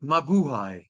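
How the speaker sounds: Mabuhay said as ma-bu-hai, falling tune; mabuhay pronunciation. Shown as a demonstration. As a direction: say Mabuhay with a clear hai ending. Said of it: "How do you pronounce that?".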